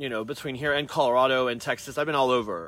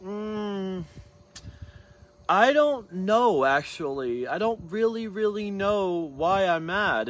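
slow, higher, stretched-out reply; drawn-out pondering; 6 semitones higher, 3 dB louder, much slower than usual. Retold or imitated. Asked by a client why he's mad, drawn-out pondering. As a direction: draw out the words as if working it out.

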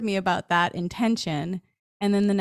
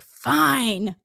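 exasperated giving in; 5 semitones higher, 5 dB louder than usual. Retold or imitated. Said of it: "finally I was like".

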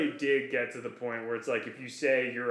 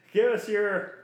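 laughing through the words, slowed and louder; real laugh pushing the words out; 7 semitones higher, 8 dB louder than usual. Real, not acted. Laughing while hosting the show.

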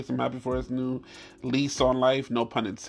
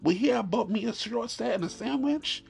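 mock jealous whine; 7 semitones higher than usual. Jealous musing in a raised voice.

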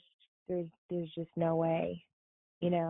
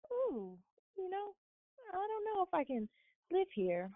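mock worried; 12 semitones higher, 3 dB quieter than usual. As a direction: sigh it out like a fussy Southern belle. Retold doubt about the snow.

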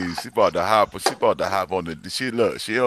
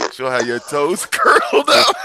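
laughing; 7 semitones higher, 8 dB louder than usual. Real, not acted. Laughing while teasing.

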